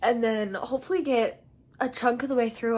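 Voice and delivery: normal talking voice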